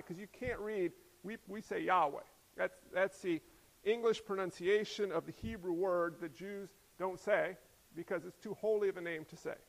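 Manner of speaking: normal talking voice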